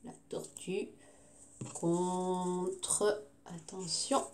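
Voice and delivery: very soft, slow build-up to a reveal; hushed suspense. Pretend. Teacher announcing an animal battle to the class, in hushed suspense.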